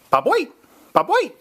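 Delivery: playful bobwhite quail call; bobwhite whistle call. Shown as a demonstration. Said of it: "I'll do the sound".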